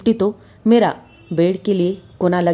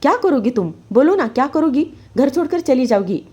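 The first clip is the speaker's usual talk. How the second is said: high, raised angry taunts; furious taunting; 6 semitones higher than usual. Acted. Husband threatening wife before slapping her, furious. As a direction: bait her, daring her to leave. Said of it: "वो ज़ोर से कहने लगे".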